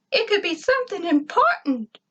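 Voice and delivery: eager little robot; robot Gur. A narrator's reading. Gur urges his master to look, eager.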